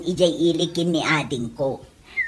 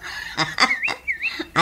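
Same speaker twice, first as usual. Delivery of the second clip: high giggle; 6 dB quieter than usual. Acted. Laughing with neighbor.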